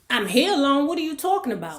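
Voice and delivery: high, loud exasperated retort; irritated. Retold or imitated. Young woman inwardly answering the landlady's question about being alone, irritated. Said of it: "She was kinda irritated".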